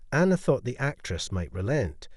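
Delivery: ordinary delivery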